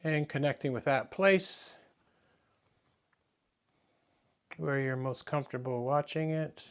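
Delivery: soft, unhurried meditation guidance with long pauses; guided meditation. Real, not acted. Teacher guiding the class to relax into the breath, soft meditation voice. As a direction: settle them quietly into the breath, softly.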